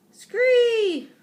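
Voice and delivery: very high, loud, long falling screech; frog screech. A narrator's reading. Frog replies to the hamster, screeching. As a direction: shriek like an excited frog. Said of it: "Og replied".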